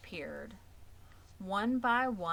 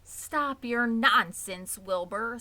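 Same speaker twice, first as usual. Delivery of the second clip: loud, slow rebuke; scolding; 4 semitones higher, 7 dB louder, slower than usual. Acted. Oldest sheep scolding the noisy pig, loud and slow. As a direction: cut him off sharply.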